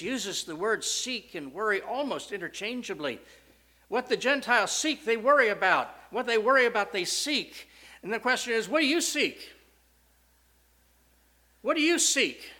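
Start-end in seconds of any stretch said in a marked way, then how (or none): 3.9-12.5 high, loud, driving pulpit challenge; climactic preaching. Acted. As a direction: hammer the question at the congregation.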